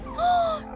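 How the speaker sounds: quick intake of shock; dismayed gasp. Real, not acted. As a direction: gasp at a sad surprise. Dismayed reaction to video.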